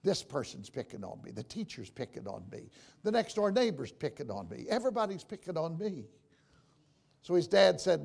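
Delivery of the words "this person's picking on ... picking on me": mealy-mouthed whining. Retold or imitated. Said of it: "mealy-mouthing around to his father".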